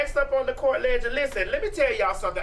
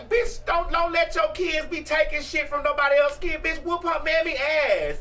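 heated shout; 5 semitones higher than usual. Real, not acted. A host fired up telling parents to defend their kids, shouting. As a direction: yell it like a fed-up parent.

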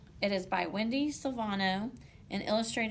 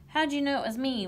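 higher, louder mild wail; 6 semitones higher, 6 dB louder than usual. A narrator's reading. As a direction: wail it lightly as the dismayed turkey. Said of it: "wailed Turkey".

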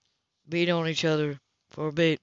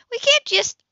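high shocked sputter; 5 dB louder than usual. Acted. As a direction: cut off mid-protest, very high.

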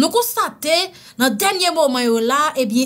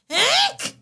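hiccup; 12 semitones higher than usual. Acted. Hiccup sound.

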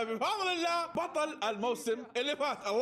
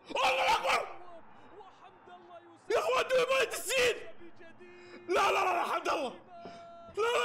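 goal celebration; 9 semitones higher than usual. Real, not acted. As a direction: scream with joy at the goal. Goal celebration, fan shouting.

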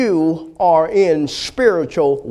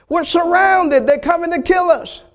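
panicked high cry; 11 semitones higher than usual. Acted. Servant panics at the army.

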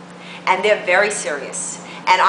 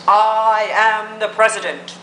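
slow, drawn-out mock president; slow self-important president send-up; mock presidential; slower than usual. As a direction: quote the president slowly, stretching the words. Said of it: "saw what the president said".

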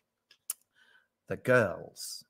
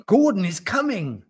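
high, loud storybook roar; 9 semitones higher, 6 dB louder than usual. A narrator's reading. Tiger passes news to the seal, roaring. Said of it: "roared the tiger".

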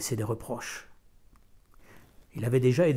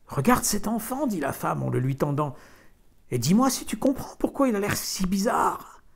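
put-on higher, urgent young mother; anxious wife; 6 semitones higher, 3 dB louder than usual. A narrator's reading. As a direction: press him to look, uneasy. Wife voiced in the story.

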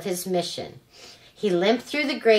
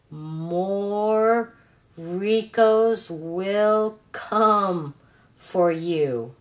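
slow, quiet, garbled dying robot; much slower than usual. Acted. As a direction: drag it out slowly like a broken machine. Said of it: "in a quiet, garbled voice".

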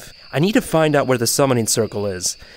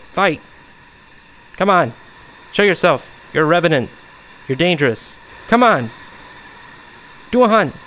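fake confidence; 4 semitones higher than usual. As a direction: bluff like you aren't scared. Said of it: "I'm acting, like, so confident".

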